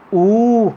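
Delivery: ऊ held long and high; long vowel ऊ. Shown as a demonstration. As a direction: stretch out ऊ. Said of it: "दीर्घ स्वर ज़्यादा समय लग रहा है".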